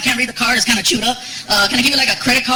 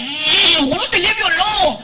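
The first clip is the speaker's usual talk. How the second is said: put-on annoyed kid; 6 semitones higher, slower than usual. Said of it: "Frankie starts getting annoyed".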